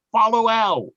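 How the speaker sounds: triumphant call. Acted. Calling out triumphantly to Tesla.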